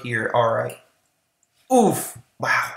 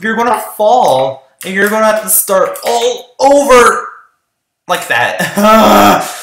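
loud scolding; 4 semitones higher, 10 dB louder than usual. Real, not acted. Scolding other players.

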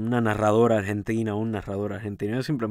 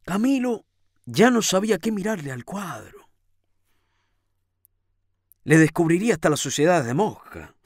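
higher, emphatic storytelling voice; story narration; 9 semitones higher, 4 dB louder than usual. A narrator's reading. Rereading the line about Camilo and the painting, emphatic narration. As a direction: pick the tale back up with raised pitch. Resumes reading after aside.

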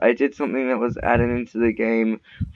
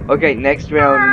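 normal talking voice

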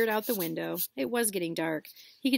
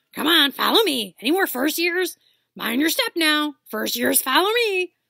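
very loud, higher giant's call; 8 semitones higher, 10 dB louder than usual. Acted. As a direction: herd the students along. Hagrid leading first years.

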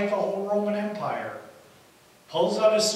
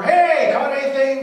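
loud, high call across water; shouted hail, pitched up; yell from shore; 8 semitones higher, 13 dB louder than usual. Acted. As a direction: holler to a distant boat. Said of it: "voice yells from the shore".

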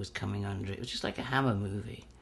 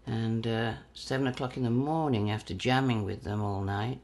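usual speaking voice

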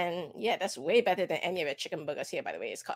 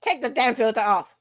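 loud; 7 dB louder than usual. Real, not acted. Demanding filter removal.

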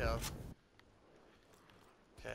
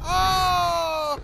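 loud, high startled cry; surprised shout; 14 semitones higher, 14 dB louder than usual. Real, not acted. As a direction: blurt it out in shock. Sudden surprise during the match.

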